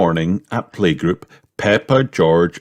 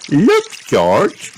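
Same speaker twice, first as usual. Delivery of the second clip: grab his attention with excitement; child calling her brother to look; excited call; 8 semitones higher than usual. A narrator's reading. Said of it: "cried Peppa".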